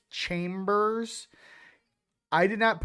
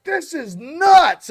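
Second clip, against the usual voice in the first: amazed shout; 9 semitones higher, 10 dB louder, much slower than usual. Retold or imitated. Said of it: "He's like".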